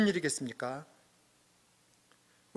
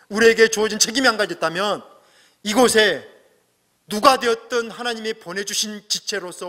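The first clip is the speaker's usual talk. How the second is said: rising, louder pulpit appeal; impassioned preaching; 6 semitones higher, 6 dB louder than usual. Acted. Pastor urging the congregation to value each other, in full preaching voice. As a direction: preach it out to the whole church.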